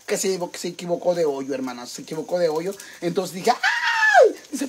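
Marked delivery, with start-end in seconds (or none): 3.5-4.3 high, drawn-out cry of pain; hammed-up squeal as if suddenly hurt; mock pained yelp. Woman retells how she cried out, mock yelp.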